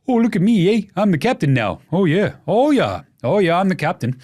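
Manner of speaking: hammed-up Canadian accent; badly faked Canadian accent. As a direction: put on a goofy mock-Canadian voice. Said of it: "but in a Canadian accent".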